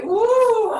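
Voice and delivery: loud, high cheer of relief; whoop of relief. Real, not acted. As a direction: let out a happy whoop. Relief after finishing hold.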